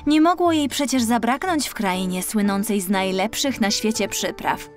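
ordinary delivery, nothing marked

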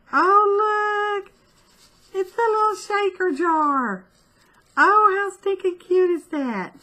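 high-pitched delighted cooing; gushing over cuteness. Real, not acted. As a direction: coo over something tiny and adorable. Gushing over little shaker jar.

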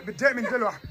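ordinary talk, nothing marked

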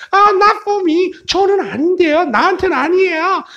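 very high, whiny cartoonish complaint; high-voiced protesting character. Speaker A saying math is never easy, high protesting voice. 근데 뭐라 그래요?.

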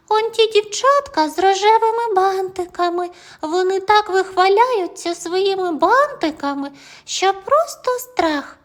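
put-on high, gentle little girl soothing; comforting girl. A narrator's reading. Joli comforting Tim, high gentle girl voice.

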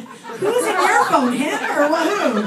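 cracking up while grilling him; laughing interrogation. Real, not acted. Mock-interrogating a laughing audience member.

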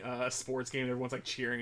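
ordinary delivery

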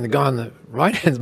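usual speaking voice

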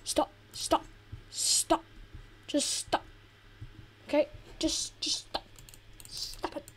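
urgent whisper; whispered pleading stops. Real, not acted. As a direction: hiss it under your breath, pleading. Pleading at screen companion.

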